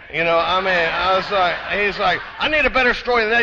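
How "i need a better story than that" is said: fast, high, louder interrogation; rapid-fire demand from a hard customs guy; barking officer. Retold or imitated. Customs officer grilling the traveler, barking fast. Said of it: "they're barking".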